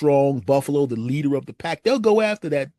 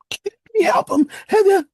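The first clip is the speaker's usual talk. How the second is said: frantic squeal; 8 dB louder than usual. Acted. Pleading while held back.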